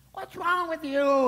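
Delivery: high, loud, stretched-out scolding; exasperated outburst. Acted. Preacher berating people who insult others, exasperated. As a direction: cry it out in exasperation.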